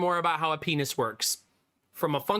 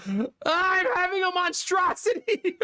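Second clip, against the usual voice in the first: put-on laughing toddler yell; 13 semitones higher, slower than usual. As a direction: shout it like a desperate kid, cracking up. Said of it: "Belf is just like".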